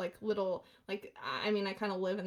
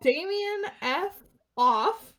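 mock indignant scolding; 4 semitones higher than usual. Retelling scolding a friend who fibbed, in mock outrage.

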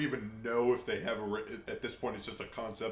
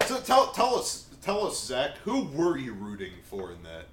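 demanding; 3 semitones higher, 6 dB louder than usual. Pretend. Mock interrogation of a teammate.

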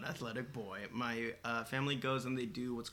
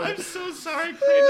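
high laughing apology; 13 dB louder than usual. Real, not acted. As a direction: squeal through laughter.

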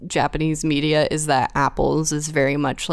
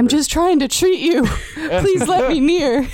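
pleading nurse; 10 semitones higher, 4 dB louder than usual. Pretend. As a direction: beg sweetly to help. Playing nurse offering apples.

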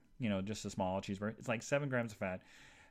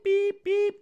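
high loud beep beep like a car; car horn beeps; 12 dB louder than usual. Retold or imitated.

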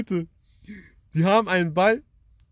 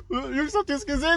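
high-pitched reenacted amazement; excited calling out; 11 semitones higher than usual. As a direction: call to the lads in disbelief.

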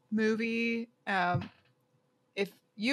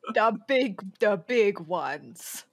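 drawn-out, badly faked Russian; botched Russian accent; slower than usual. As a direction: let the fake Russian accent fall apart.

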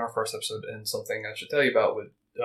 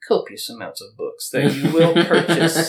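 put-on old mystic voice; 3 semitones higher than usual. Voicing old Davis selling books.

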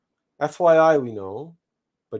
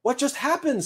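high, urgent question; startled seed; 13 semitones higher than usual. Acted. Seed asks the returning squirrel for news, urgent. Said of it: "said I".

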